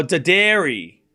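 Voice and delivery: Daderi as duh-DAIR-ee, short first syllable; slow Daderi with the weight on DAIR; pronunciation option. Shown as a demonstration. Streamer offers chat the last option, duh-DAIR-ee for Daderi. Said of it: "which one".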